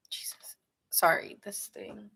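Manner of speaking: real whispered apology off-mic; muttered aside. Real, not acted. A streamer fumbling with gear, whispering an aside. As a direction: whisper it to yourself while fixing gear.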